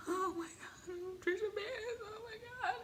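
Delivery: tiny high squeal, nearly crying; soft, high-pitched mock whimper; overwhelmed fangirl. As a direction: imitate a fan tearfully losing it, very softly. Acts out meeting her idol.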